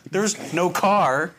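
normal talking voice

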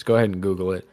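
ordinary talk, nothing marked

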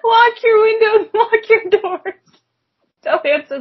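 high, laughing mock warning. Real, not acted. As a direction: cry it out through laughter.

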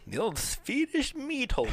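high mock-grand "the old sphincter swisher"; hammed-up product voice. Joking product names with a friend, high hammed-up voice. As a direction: present it like a silly ad.

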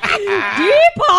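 loud, high, laughing dramatic cry of a name; over-the-top mock wail of a name; super dramatic call. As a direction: call out in hammed-up despair. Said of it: "super dramatically".

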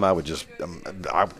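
ordinary delivery

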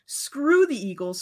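high-pitched mock fury at the Eagles; mock angry rant. Fan venting at rival team.

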